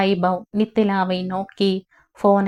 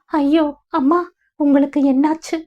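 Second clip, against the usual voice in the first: high, fast alarmed cry; panicked; 7 semitones higher than usual. Acted. Nithila hearing of the heart attack, panicked and high. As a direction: gasp it out in sudden alarm. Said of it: "பதறியவளை".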